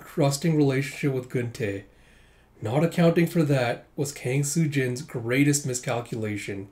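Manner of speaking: ordinary delivery, nothing marked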